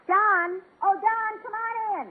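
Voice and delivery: high call across to the car; sing-song call toward the car. Real, not acted. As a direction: call him in from outside. [name] calling Don in from the car.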